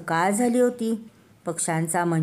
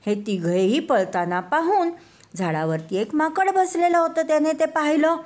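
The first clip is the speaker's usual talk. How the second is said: plain reading voice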